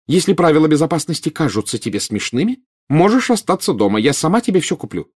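high-pitched, scolding mother; flaring up. A narrator's reading. Mother threatens to leave son at home, cross. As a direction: lay down the threat indignantly. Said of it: "немедленно вспыхнула миссис Уизли".

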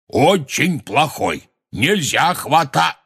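high, slow, stretched-out reprimand; scolding. A narrator's reading. Hagrid telling the giant not to grab, scolding. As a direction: scold like a naughty child is listening.

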